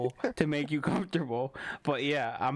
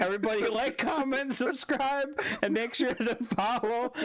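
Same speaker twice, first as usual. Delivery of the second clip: trying not to laugh; 10 semitones higher than usual. Acted. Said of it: "I can't even fucking say it with a straight face".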